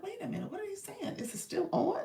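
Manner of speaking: high-pitched retold disbelief; high, bewildered self-imitation; mock confused reaction. Acting out her confused reaction.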